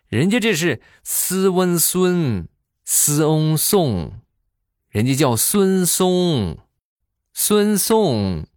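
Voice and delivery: 斯温孙 stretched slowly as Swenson; sounding out 孙颂 slowly and high; drawn-out foreign name. Shown as a demonstration. Uncle sounding out the foreign name to his nephew, slow and high.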